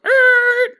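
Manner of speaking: high squealed car brake noise; screeching brakes. Pretend. Stopping the car before everyone hops out, screeched brakes. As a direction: squeal like tires stopping short.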